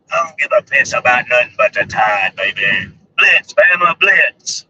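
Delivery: hoarse-voiced hype fan catchphrase, low then punched loud; Bama football fan. Vlogger imitating an Alabama football superfan, Bama fan voice. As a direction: impersonate the Alabama football guy, dropping low on "baby". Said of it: "I sound like him".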